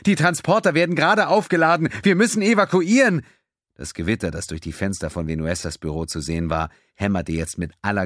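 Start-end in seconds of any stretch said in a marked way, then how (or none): 0.1-3.2 much higher, louder plea; frantic shouted warning; urgent shouting. A narrator's reading. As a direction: urge evacuation over the noise. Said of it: "protestierte Panganiban".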